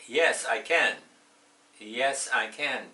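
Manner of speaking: loud, eager I can; bright loud answer. Acted. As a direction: answer proudly and loudly. Teacher acts cheerful yes answer.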